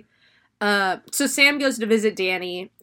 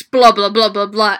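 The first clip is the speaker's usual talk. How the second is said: louder blah-blah rant; mock ranting; 8 dB louder than usual. Roommates ranting about masks, mock loud blah. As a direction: rattle off blah blah loudly. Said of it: "they're like".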